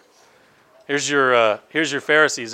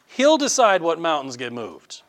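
ordinary talk

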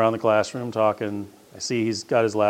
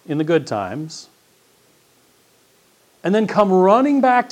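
much higher and louder, driving hard; fiery preaching; 7 semitones higher, 7 dB louder than usual. Acted. Pastor warning the congregation, fiery sermon build. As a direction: hammer the point home.

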